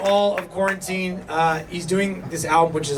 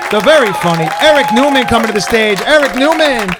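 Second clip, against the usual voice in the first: announcer shout; 7 semitones higher, 6 dB louder than usual. Real, not acted. Host bringing the comic to the stage with a booming announcer shout. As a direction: pump up the crowd like a club emcee.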